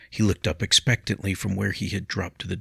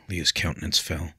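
ordinary delivery, nothing marked

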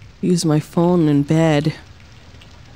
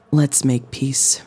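normal talking voice

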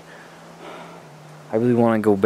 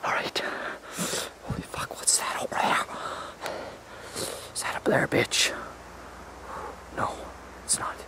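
softer, breathy hushed whisper; scared whisper; 11 dB quieter than usual. Acted. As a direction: whisper nervously so nothing hears.

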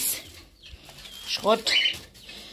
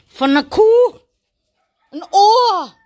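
surprised disgust; 7 semitones higher, 8 dB louder than usual. Real, not acted. Finds a cow ear piece.

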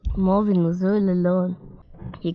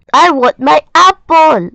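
high, loud furious monster yell; tantrum yell; 8 semitones higher, 11 dB louder than usual. A narrator's reading. Marvin raging at Molly over his apple, furious yell. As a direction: scream it like a stamping angry monster.

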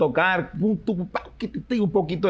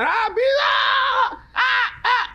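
high, slow jeering calls; mock taunting; much slower than usual. Kids in town jeering at the boy who never played. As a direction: ham up the schoolyard mockery. Imitating kids mocking him.

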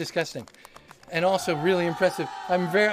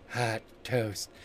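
low, soft sultry murmur; low husky tease; 9 semitones lower, 5 dB quieter than usual. Acted. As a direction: breathe it seductively. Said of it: "That's not how you normally talk".

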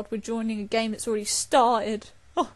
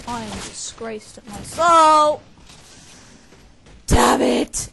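loud frustration; 11 dB louder, slower than usual. Real, not acted. Frustrated after dying.

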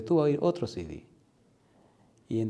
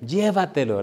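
higher, louder pleading mother; insistent urging; 4 semitones higher, 6 dB louder than usual. Retold or imitated. A mother pressing her son to take it along, insistent.

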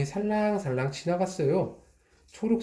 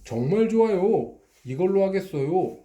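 higher and louder, keen to buy; eager customer; 6 semitones higher, 4 dB louder than usual. Acted. Customer eagerly buying the pencil, higher and louder. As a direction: snap it up with delight.

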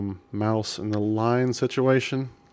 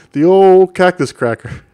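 much higher and louder, playfully announcing the find; amused exclamation; 8 semitones higher, 12 dB louder than usual. Real, not acted. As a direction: proclaim it like a grand discovery, laughing inside. Amused at finding a cracker.

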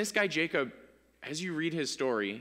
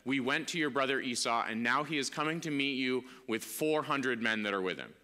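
measured, lower Bible reading voice; scripture reading; 4 semitones lower, 4 dB quieter than usual. Acted. Pastor reads Jacob's story to the congregation, measured and low. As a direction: read the verse steadily to the congregation.

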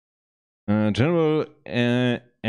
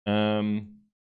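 usual speaking voice

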